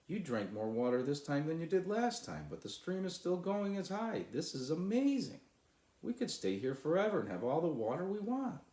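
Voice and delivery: bubbling over with amazement, almost squealing; excited shouting. Acted. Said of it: "shouted Sly".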